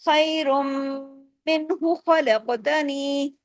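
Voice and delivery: "खैरुम मिन्हु" with the m hummed long; slow chanted "खलकतनी", heavy kha and long last vowel; joined model recitation. Shown as a demonstration. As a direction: link "खैरुम मिन्हु खलकतनी" in measured tajweed reading. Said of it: "ऐसे पढ़ा जाएगा".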